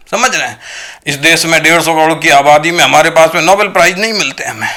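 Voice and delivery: ordinary talk, nothing marked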